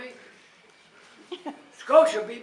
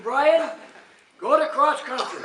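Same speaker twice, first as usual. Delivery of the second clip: calling out; 5 semitones higher, 9 dB louder, slower than usual. Retold or imitated. Coach telling player to switch.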